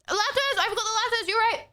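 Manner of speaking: high sudden realisation; suddenly remember something; surprised. Real, not acted.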